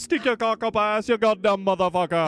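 put-on lightly done Jamaican rude boy demon. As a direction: toss off a Jamaican patois curse. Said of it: "It's a rude boy voice".

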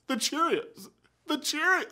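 mock weepy. Mocking someone who cries at Cheerios ads, teary and soft. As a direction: play the viewer choking up at an ad. Crying at a cereal commercial.

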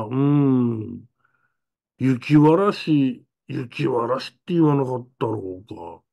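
ゆっくり思い出すように; drawn-out slow remembering; slow recall. Retold or imitated. Grandma slowly recalls word. (祖母が言葉を探すように、ゆっくりと)

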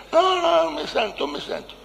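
very high imitated woman; high female whine, fading away; mock whining refusal. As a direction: protest in a put-on high wail. Mimics her refusing in high voice.

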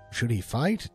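plain reading voice

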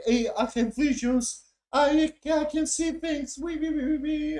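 high, silly mock-mystical voice; mocking bad acting. Reviewer imitating the actors' visions scene, mocking. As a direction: send up the actors in a high squeal. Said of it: "They were talking like".

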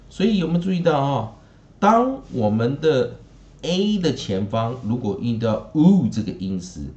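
normal talking voice

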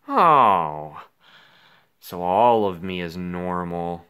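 usual speaking voice

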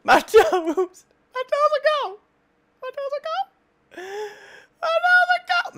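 high, strained comic lament; mock wailing. Gamer mock-wailing over his lost toes, high. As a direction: wail about the lost toes in mock agony.

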